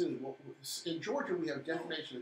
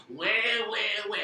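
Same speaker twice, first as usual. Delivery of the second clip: mock crying wah; 5 semitones higher, 7 dB louder, much faster than usual. Mocking officials' complaints.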